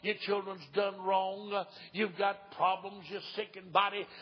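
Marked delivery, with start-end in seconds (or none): none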